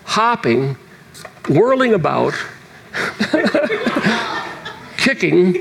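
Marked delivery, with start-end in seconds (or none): 2.9-4.7 high bubbling laugh. Real, not acted. Preacher laughing while recounting his dance. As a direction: burst into a giggle.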